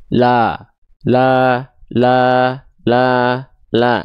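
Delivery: "La" repeated long with open mouth, no smile; open, unsmiling A on each held "la"; buka mulut saja. Shown as a demonstration. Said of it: "buka mulut saja pelafalannya begini". ("la" buka mulut saja, tanpa senyum)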